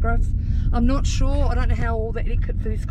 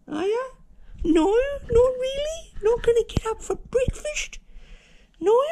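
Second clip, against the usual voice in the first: pet talk; 12 semitones higher than usual. Real, not acted. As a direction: coo at the dogs like babies. Morning baby talk to pets.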